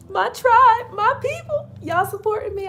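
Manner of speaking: high, loud, overwhelmed with joy; overjoyed. Retold or imitated. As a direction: squeal it way up, touched. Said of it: "I'm like".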